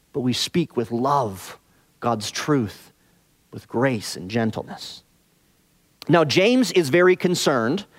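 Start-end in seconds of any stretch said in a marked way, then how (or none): 0.1-5.0 dropped soft and slowed, tender appeal; tender softening. Real, not acted. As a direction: ease off, let the love be heard. Pleading for gentle, loving speech.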